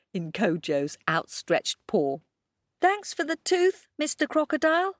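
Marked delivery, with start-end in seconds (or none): 2.8-5.0 cheeky shout. A narrator's reading. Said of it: "shouted Kojo".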